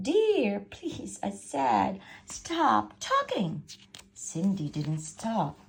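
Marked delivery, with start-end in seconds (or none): 0.1-1.2 high, loud exasperated "dear"; higher, louder exasperated stepmother; exasperated plea. Acted. Stepmother exasperated by nonstop chatter. 2.3-3.6 slow, high, louder "stop talking"; a slow, exasperated order; fed-up command. Acted. Stepmother exasperated by nonstop chatter.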